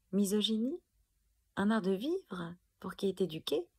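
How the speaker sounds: ordinary talk, nothing marked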